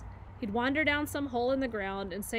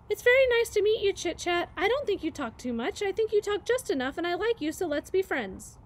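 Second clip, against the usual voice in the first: high, earnest little voice; sweet gosling; 8 semitones higher, 5 dB louder than usual. Acted. Gosling offers friendship to the squirrel, high earnest voice. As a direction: say it kindly and bravely as the little gosling. Gosling answers after deep breath.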